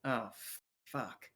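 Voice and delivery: soft, low curse under the breath; dismayed realisation. Acted. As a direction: quietly dread being spotted by a creditor. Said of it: "looks and goes".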